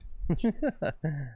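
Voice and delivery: short uneasy chuckle; nervous laugh. Real, not acted. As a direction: laugh awkwardly at bad news. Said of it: "It's a nervous laugh".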